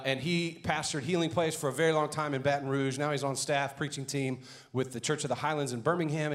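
ordinary talk, nothing marked

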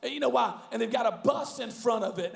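normal talking voice

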